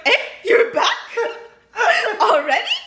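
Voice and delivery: amused imitation of a startled question; mock surprise. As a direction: act out relatives caught off guard, pitch jumping up. Mom and Tiffany's surprised greeting, retold.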